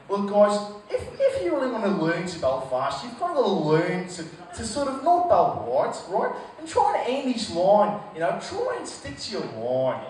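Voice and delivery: a lightly voiced Australian coach. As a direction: coach giving tips in a hint of Australian accent. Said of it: "imagine the Australian coach who's".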